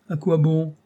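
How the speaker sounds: a touch of despairing groan; moaning. Acted. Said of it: "gémit Paul".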